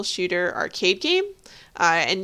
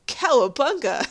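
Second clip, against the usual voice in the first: mildly raised cartoon battle cry; mock yell; 4 semitones higher than usual. Co-host imitating Bob entering the sewers, mock yell. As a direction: call it out like a cartoon hero, half-strength. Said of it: "Bob yells".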